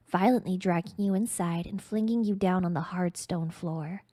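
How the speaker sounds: ordinary delivery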